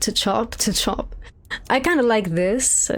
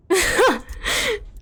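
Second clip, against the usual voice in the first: a high squealing burst of laughter; 14 semitones higher than usual. Real, not acted. Streamer squealing at the awful character look.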